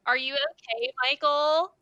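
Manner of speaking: mock nurse calling. Nurse knocking on the hospital bathroom door. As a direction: call out to the couple inside.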